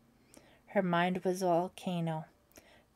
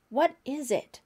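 called out higher and louder, startled; shout; 7 semitones higher, 6 dB louder than usual. A narrator's reading. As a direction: shout into the dark room. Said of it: "someone shouted".

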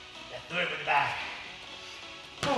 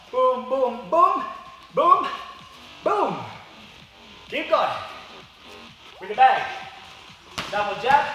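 boxing coach calling combos; 3 semitones higher, 5 dB louder, slower than usual. Real, not acted. Coach shouting strikes on the bag.

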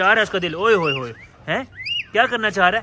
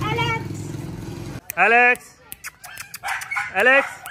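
calling out; 8 semitones higher, slower than usual. Real, not acted. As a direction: call the cat from afar. Calling the cat Alex. (ज़ोर से पुकारना)

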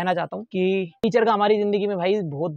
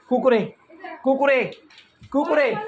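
higher each time, Kurkure sung out; calling out; 6 semitones higher, 3 dB louder than usual. Real, not acted. Vlogger teasing a child about his snack, calling out higher. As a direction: tease with Kurkure, climbing higher.

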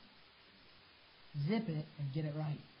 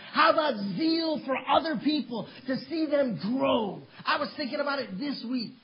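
preaching at full force; 5 semitones higher, 8 dB louder than usual. Acted. Preacher crying out to the congregation at full force. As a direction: build the sermon to a shouting climax.

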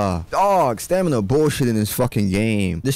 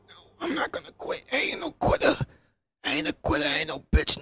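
mock high voice; faster than usual. Real, not acted. Gamer refusing to quit, high mock voice. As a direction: squeak it out, mock defiant.

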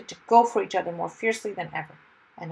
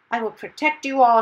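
higher, booming heroic shout; loud shout; 5 semitones higher, 10 dB louder than usual. A narrator's reading. Hero to terrified crowd facing the dragon, loud shout. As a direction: proclaim it loudly over the panic. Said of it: "shouted Mister Wumble even louder".

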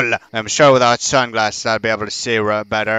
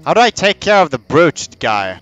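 ordinary talk, nothing marked

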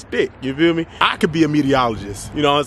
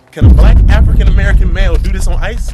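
loud, high show-off shout; proud louder call on the ice; excited boast; 5 semitones higher, 10 dB louder than usual. Real, not acted. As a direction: brag loudly while sliding. Showing off sliding on ice.

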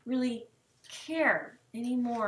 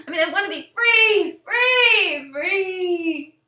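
loud, higher hammed-up crying yell; mock sobbing shout; 5 semitones higher, 7 dB louder, slower than usual. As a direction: wail it out like a trapped teenager. Teen diary cry for freedom.

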